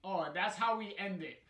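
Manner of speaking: laughing loudly at the ending. Real, not acted. Player laughing at the game's ending, loud and high.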